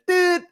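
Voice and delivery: high, short imitated beep; answering machine beep. Imitating the answering machine tone, high mock beep. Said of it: "phones with answering machines".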